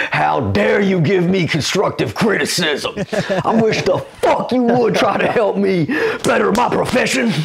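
high, hammed-up indignation; put-on indignant rant, high-pitched; mock outrage. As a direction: play offended fury for laughs, pitch climbing. Mocks the comic's outrage at advice.

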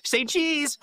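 high, teasing call to pose for a picture; playful photo call. Acted. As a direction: sing out "say cheese" like a gleeful tease. Teacher snapping photo of students.

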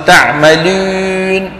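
تعملون with long و fronted to ü; واو مخلوطة بالياء. Shown as a demonstration. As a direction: wrongly stretch تعملون on a French-u vowel. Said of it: "ما بيصيروا". (تعملون بواو مائلة إلى الياء)

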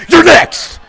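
high, forceful shout; shouted threat. Real, not acted. Player threatening the next target, shouting. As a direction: yell the warning with the voice shooting up. Threat repeated louder, higher.

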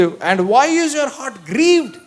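high, very slow question; drawn-out reading. Acted. Reads English verse as pleading husband. (సాగదీస్తూ అడిగినట్టు)

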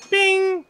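very high, loud bing; ping it out like a bell; piercingly high playful sound effect; imitated chime. Imitated chime during gameplay.

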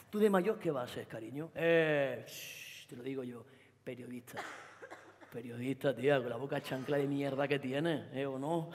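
low, soft, contemptuous; belittling boyfriend. Acted. Boyfriend belittling his girlfriend, contemptuous. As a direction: talk down to her like a child.

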